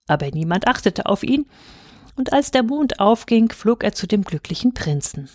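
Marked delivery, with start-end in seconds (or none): none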